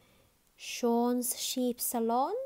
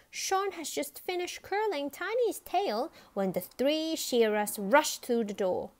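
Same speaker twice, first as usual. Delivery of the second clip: ordinary delivery, nothing marked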